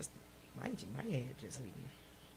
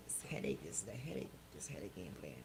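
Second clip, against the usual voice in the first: whispered; 8 semitones lower, 3 dB quieter than usual. Real, not acted. Headache, speaking softly.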